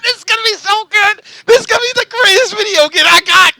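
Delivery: laughing high with glee. Real, not acted. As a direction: giggle through the words, thrilled.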